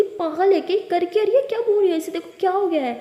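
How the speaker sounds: high, loud exasperation; outraged exclamation. Acted. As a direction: rant in disbelief at her behaviour. Sister outraged after quarrel.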